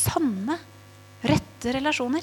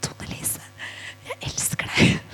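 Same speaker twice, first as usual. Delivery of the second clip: tearful whisper; slower than usual. Retold or imitated. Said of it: "gråter hun i andre enden".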